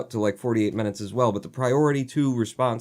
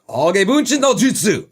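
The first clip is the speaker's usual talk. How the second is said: higher, louder ninja battle cry; anime jutsu call; 11 semitones higher, 8 dB louder than usual. Pretend. Joking about Naruto's kunai, shouting the jutsu name.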